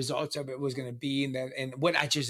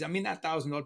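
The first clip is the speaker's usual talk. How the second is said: ordinary talk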